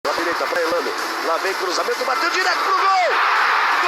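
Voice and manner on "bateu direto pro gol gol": goal commentary shout. Acted. Live goal call.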